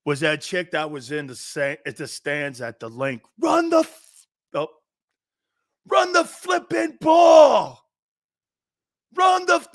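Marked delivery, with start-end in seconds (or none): 3.4-4.3 high, loud yell from the stands; mock fan yell. Imitating a fan yelling at the coach from the stands. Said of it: "she was just in the stands screaming". 5.9-9.7 high, loud yell from the stands; mock fan yell. Imitating a fan yelling at the coach from the stands. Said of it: "she was just in the stands screaming".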